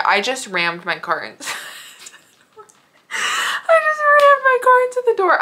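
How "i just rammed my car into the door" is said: squeaky much higher laugh-cry, louder; tearful helpless laughter. Real, not acted. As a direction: lose it laughing mid-sentence. Laughing retelling the door ram.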